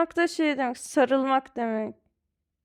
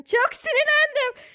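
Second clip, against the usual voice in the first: much higher, louder, exasperated "Çok sinirlendim."; acted anger; 14 semitones higher, 4 dB louder than usual. Shown as a demonstration. Teacher acts out being furious for the student, high and loud. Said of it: "Ay çok sinirlendim".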